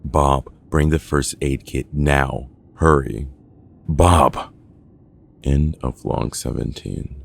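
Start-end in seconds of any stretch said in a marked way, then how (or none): 0.0-4.5 loud, commanding call for help; urgent yell. A narrator's reading. Carter yelling to Bob for first aid. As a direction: yell for help, building louder. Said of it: "Carter yells out".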